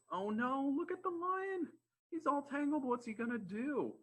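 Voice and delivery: very high, squeaky storybook panic; mock alarm. Storyteller frets over the netted lion, mock alarm. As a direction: fret in a high-pitched, hammed-up voice.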